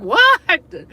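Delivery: a sudden high, loud what; shocked double-take on the word; surprised what. Retold or imitated. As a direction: caught off guard, mid-story. Reacting to a discovery.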